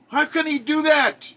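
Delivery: high, outraged demand for the missing father; outraged cry. Real, not acted. Railing at the absent father, high and indignant. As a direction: throw the question up in disbelief.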